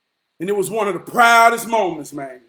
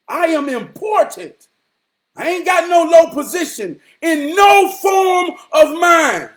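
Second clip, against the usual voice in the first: loud, high motivational shout; fired-up proclamation; 6 semitones higher, 5 dB louder than usual. Real, not acted. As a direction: proclaim your worth at full voice, pitched up. Fired-up motivational self-assertion.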